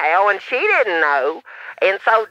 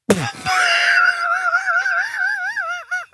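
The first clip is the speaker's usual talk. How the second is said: shrieking laugh. Acted. Shocked by search result.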